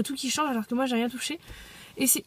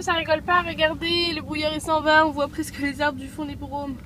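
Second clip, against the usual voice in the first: ordinary talk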